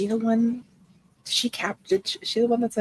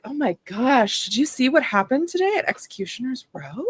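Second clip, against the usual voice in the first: higher, sweet gossipy voice; charming gossip; 6 semitones higher than usual. Acted. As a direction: chat her up with fake charm. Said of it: "charming, charm reconnaissance mission".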